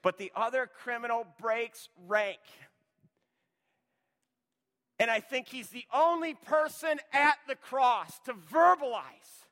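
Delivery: loud, driving pulpit preaching; preaching at full force. Acted. Preacher pressing the church to choose, loud and driving. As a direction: hammer each either-or line home to the congregation.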